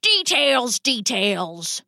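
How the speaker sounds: rat papa waving away objections, pitch dropping; dismissive. Acted. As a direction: brush it off airily. Father brushes off daughter's objection.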